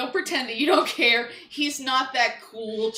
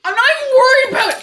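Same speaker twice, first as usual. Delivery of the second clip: high, fast outburst, much louder; loud protest; 13 semitones higher, 13 dB louder, faster than usual. Real, not acted. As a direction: shout it back in exasperated protest. Protesting partner's worry during a search.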